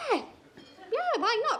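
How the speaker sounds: high, bright eager yes. Retold or imitated. Accepting a date in the park, high and eager.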